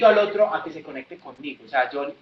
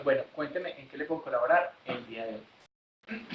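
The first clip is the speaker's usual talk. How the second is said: lowered tone; 4 semitones lower, 9 dB quieter than usual. Shown as a demonstration. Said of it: "lo bajan al que a usted le gusta".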